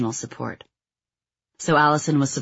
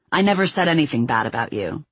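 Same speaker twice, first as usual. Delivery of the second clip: usual speaking voice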